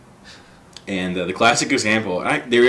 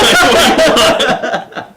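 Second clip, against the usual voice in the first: laughing through a sleepy friend's answer; a half-laughed, drawn-out groggy question; 13 semitones higher, 5 dB louder, much slower than usual. Retold or imitated. Quoting the sleepy friend on the late-night call, laughing.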